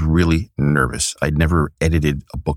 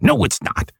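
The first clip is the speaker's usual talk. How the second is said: much higher mock correction; playful higher rebuff in the mentor voice; Stan Lee impression. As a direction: snap it back pitched way up. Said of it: "my Stan Lee impersonation".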